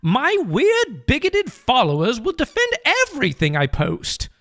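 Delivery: high-pitched put-on British author; J K Rowling. Rowling answers her exasperated agent, high British voice. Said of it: "I will be J K Rowling".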